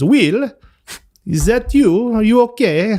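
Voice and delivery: put-on high-pitched, strong Neapolitan Italian accent. Former colleague from Naples spotting him delivering pizza, strong Italian accent. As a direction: imitate a concerned Italian friend with a thick accent. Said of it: "I'll do his accent. It's pretty strong".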